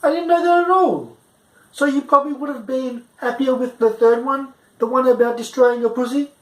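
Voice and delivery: rising high incredulity; stunned. Retold or imitated. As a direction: caught completely off guard. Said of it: "I'm like".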